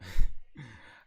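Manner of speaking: loud, short burst of laughter. Real, not acted. Player amused by the sibling teasing, loud laugh.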